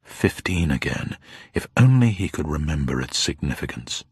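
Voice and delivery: ordinary delivery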